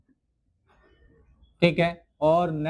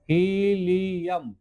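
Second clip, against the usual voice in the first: Helium stretched out slowly, syllable by syllable; slow careful pronunciation; much slower than usual. Shown as a demonstration. Teacher models helium slowly for students, drawn out. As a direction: drag helium out so students can repeat it. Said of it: "आप इसका नाम लीजिए".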